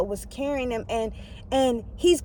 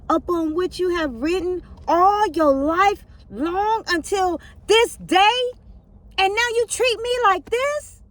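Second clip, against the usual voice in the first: slow plea rising higher to a cry; pleading donkey; 7 semitones higher, slower than usual. Acted. The donkey reproaching Balaam, slow plea rising to a cry. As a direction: build the plea until it breaks high. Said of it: "the donkey said to Balaam".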